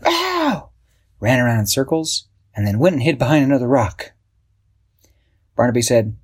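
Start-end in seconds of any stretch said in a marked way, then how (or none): 0.0-0.7 high, loud frightened yelp; startled boy's high yelp; scared ow. Acted. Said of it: "threw his hands up and went".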